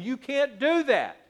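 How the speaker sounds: retold scolding. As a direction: call out sharply to the runaway child.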